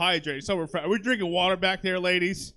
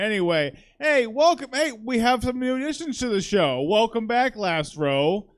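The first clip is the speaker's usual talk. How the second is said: normal talking voice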